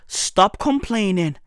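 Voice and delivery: ordinary talk, nothing marked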